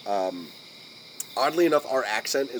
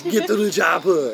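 loud, higher action-hero yell; Schwarzenegger; 5 semitones higher, 7 dB louder than usual. Acted. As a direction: shout it in a thick Austrian accent. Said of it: "With Schwarzenegger".

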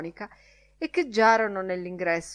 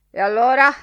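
sudden loud, higher question; startled; 5 semitones higher, 13 dB louder, faster than usual. A narrator's reading. Miles Crawford starting up with a question, startled and loud. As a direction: jolt into the question, loud and abrupt. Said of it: "disse con un sussulto".

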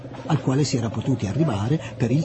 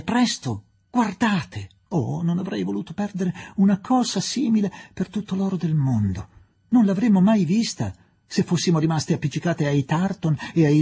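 exaggerated enthusiasm; 6 semitones higher than usual. Girl urging the women to see the dawn, gushing. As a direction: overplay the delight as if nothing could be finer. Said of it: "esagerando il proprio entusiasmo".

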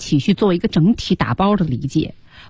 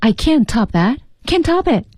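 higher-pitched acted line, jumping up on the repeat; acted exclamation; 7 semitones higher than usual. Teacher acting out the character's line for students, frantic and higher. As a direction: re-enact a frantic character, pitch leaping at the end.

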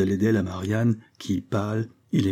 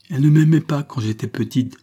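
raised, louder protest; impetuous cry; 6 semitones higher, 7 dB louder than usual. A narrator's reading. Girl protesting against her mother, crying out impetuously. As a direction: burst out hotly with pitch up. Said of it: "s'écria impétueusement Angèle".

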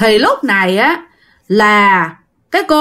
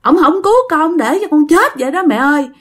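the dead son, through the possessed woman, reproaching his mother about his father; reproachful ghost son; 5 semitones higher than usual. Acted. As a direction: whine a blame at the father, pitched high. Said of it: "Đằng này là trách móc".

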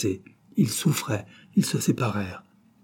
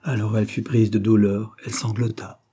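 ordinary delivery

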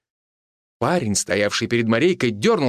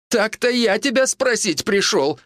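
high-pitched pitiful whine; plaintive bleat; 10 semitones higher than usual. A narrator's reading. Mitka whines to the healer for advice, plaintive. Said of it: "жалобно проблеял Митька".

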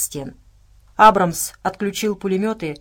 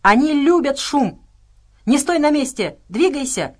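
urgent high, raised-voice warning; pitched up and pressing; shouting; 6 semitones higher, 5 dB louder than usual. A narrator's reading. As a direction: yell orders to a comrade in danger. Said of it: "крикнул Хендер".

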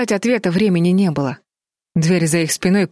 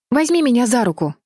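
higher-pitched, pressing call; urgent cry; 7 semitones higher than usual. A narrator's reading. Бетти calls to Колтон from the windowsill, urgent cry. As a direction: call out for help without full volume. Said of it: "крикнула она".

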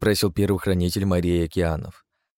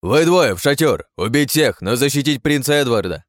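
shouting orders; 6 semitones higher, 5 dB louder than usual. Acted. Commander shouting orders in battle.

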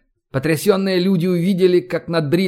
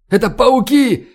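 alarmed cry; 7 semitones higher, 4 dB louder than usual. A narrator's reading. First alarm as spiders approach.

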